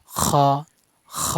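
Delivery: "খ" as a throaty fricative with rounded vowel; end-of-throat kho. Shown as a demonstration. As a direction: scrape air at the top of the throat into "খ". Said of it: "হলকের শেষ অংশ থেকে উচ্চারণ হবে". ("খ" গলার শেষ অংশ থেকে)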